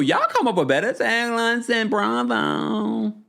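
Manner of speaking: high, slow, drawn-out praise; delighted high-pitched hype; amused gushing. Real, not acted. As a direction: stretch it out high, on the edge of laughter. Gushing before bursting into laughter.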